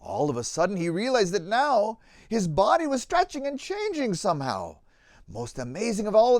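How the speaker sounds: put-on lively rhyming read-aloud for children; story narration. A narrator's reading. Narrator reading a children's Easter tale, lively storytelling. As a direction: tell the tale with bounce and warmth in the rhythm.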